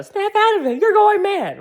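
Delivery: very high mock yell; hammed-up high movie shout. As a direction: yell like an eighties movie hero. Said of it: "they be yelling".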